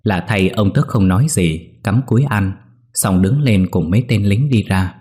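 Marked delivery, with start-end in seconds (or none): none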